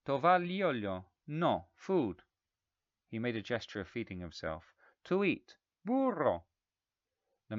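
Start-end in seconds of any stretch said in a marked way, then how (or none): 0.0-2.2 Italian guest. A narrator's reading. 5.0-6.4 loud, high, tapping syllables; loud syllable-by-syllable Italian English; emphatic Italian guest. A narrator's reading.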